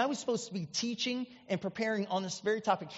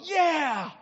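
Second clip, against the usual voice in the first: high, gleeful re-enacted cheer; jubilant, drawn-out yell; excited cheer; 7 semitones higher than usual. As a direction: cheer like a thrilled kid. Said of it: "I jump up and go".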